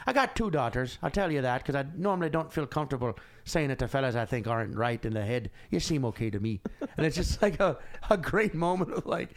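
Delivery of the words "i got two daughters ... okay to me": put-on confiding Dublin cabbie, Irish accent sinking lower at the end; Irish cab driver. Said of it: "He's like".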